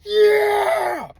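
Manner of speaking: high, slow shouted cheer; cops cheering. Retold or imitated. Police shouting over finding the vault, high slow cheer. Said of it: "They said".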